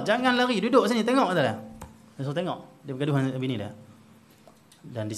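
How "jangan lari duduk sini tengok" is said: loud, high command to stay; firm call. Retold or imitated. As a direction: order the student to sit and watch. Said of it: "Tok guru dia kata apa?". (suara tegas dan kuat menyuruh duduk)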